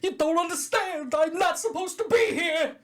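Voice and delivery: very high, slow wail; hammed-up high-pitched plea; fat ass. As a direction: wail it as the panicking inmate. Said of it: "can I be fat ass for a minute".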